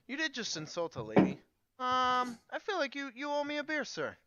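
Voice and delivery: high, loud put-on Irish lilt; put-on Irish accent. Player scolding the drunk guy for his insult, high Irish accent. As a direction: play the offended lady in a high Irish voice. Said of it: "I'll be like".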